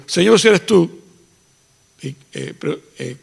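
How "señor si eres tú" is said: higher, louder doubtful plea; questioning Peter. Retold or imitated. Peter calling to Jesus on the water, doubtful. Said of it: "respondió Pedro y dijo".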